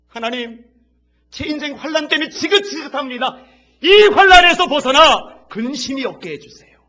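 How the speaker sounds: loud high pleading cry; desperate prayer shout. Acted. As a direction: plead at the top of your voice. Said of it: "야베스가".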